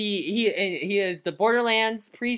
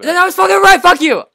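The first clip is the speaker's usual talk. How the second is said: high, loud indignant shout; angry outburst; 9 semitones higher, 11 dB louder than usual. Real, not acted. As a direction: yell back in indignant self-defence. Snaps back in accent argument.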